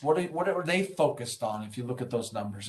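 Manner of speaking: ordinary talk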